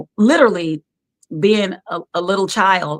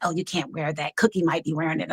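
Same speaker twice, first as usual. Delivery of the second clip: designer warning; 9 dB quieter, faster than usual. Retold or imitated.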